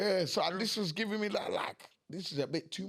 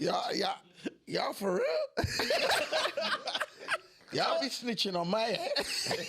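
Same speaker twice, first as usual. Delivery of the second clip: laughing through the words; cracking up in disbelief; 7 semitones higher, slower than usual. Real, not acted. Laughing disbelief at being exposed.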